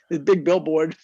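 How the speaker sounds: usual speaking voice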